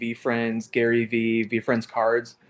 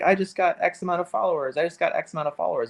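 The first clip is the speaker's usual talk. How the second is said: higher-pitched imitation of a boaster; mock follower bragging; 6 semitones higher, faster than usual. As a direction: mimic cringey bragging, pitched up. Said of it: "cringe or ick when people are like".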